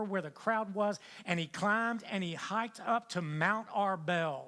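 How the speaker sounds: loud, rising sermon delivery; impassioned pulpit preaching; full-force preaching. Acted. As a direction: preach it out to the whole room.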